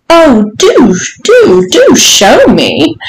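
high, drawn-out mock begging; gleeful pleading. A narrator's reading. Lady urging her visitor, high and gleeful. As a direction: stretch each plea with swooping delight.

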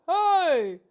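high, loud mock-angry exclamation; angry outburst. Shown as a demonstration. Host shows listeners herself when angry, mock-angry outburst. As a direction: flare up on one word. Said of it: "when I'm angry".

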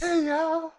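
folksy country drawl. Retold or imitated. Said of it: "The nasally high redneck nascar voice".

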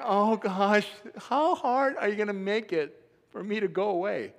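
hammed-up exasperated dad; mock exasperation. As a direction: wail it at a heart-tugging child. Acted exasperated father at parting.

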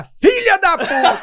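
laughing mock yell. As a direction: squeal the stranger's curse through laughter. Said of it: "o cara". (grito imitado, agudo, rindo)

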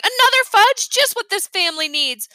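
sarcastic shout. A narrator's reading. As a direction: hurl it at the family. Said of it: "I kept right on shouting".

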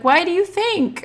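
high, pointed mock comeback; sarcastic retort. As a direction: throw the question back at her. Speaker's own retort quoted.

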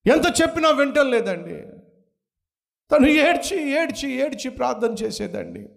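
high, loud, impassioned sermon voice; fervent pulpit cry; full-force preaching. Acted. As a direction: preach it out to the congregation. Preaching about the weeping mother.

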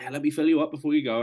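high, loud imitation of a helper; insistent checkpoint helper. Voices insistent woman at checkpoint.

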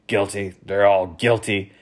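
growing suspicious grumble; suspicious muttering. Acted. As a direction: accuse everyone under your breath. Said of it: "suspiciously muttering".

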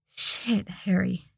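breathy half-whisper; breathed. A narrator's reading. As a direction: breathe it out, stunned. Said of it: "Draco breathed".

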